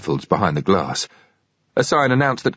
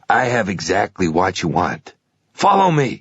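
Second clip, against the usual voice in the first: a keen clerk talking up his stock; pawnshop salesman. Acted. Pawnshop clerk voiced in story.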